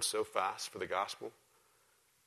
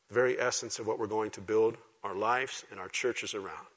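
ordinary delivery

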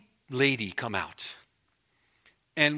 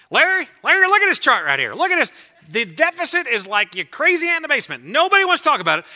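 much higher, louder Texan Perot voice; Ross Perot impression; 13 semitones higher, 12 dB louder than usual. Pastor mimics Perot on Larry King, high loud impression. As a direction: ham up Perot pitching his chart to Larry. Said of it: "He'd always go on Larry King".

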